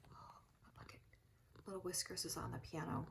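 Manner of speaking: soft whispered aside; an aside to the daughter filming, whispered. Real, not acted. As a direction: lean in and whisper it.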